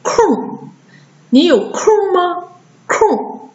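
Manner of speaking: 空 as nasal r-coloured "kur", high falling; nasalised erhua. Shown as a demonstration. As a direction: model 空 with ng melted into curled r. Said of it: "跟老师给你们读一下啊".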